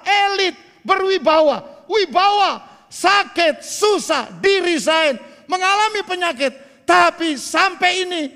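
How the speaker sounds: loud, rising sermon climax with shouted words; preaching at full force. Acted. Pastor urging the congregation through trials, loud and fervent. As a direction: preach it out, lifting the church.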